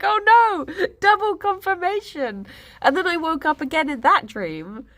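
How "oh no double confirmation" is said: high-pitched, hammed-up dismay; mock dismay. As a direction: react with comic alarm, voice jumping up. Retold dismay at confirmed divorce.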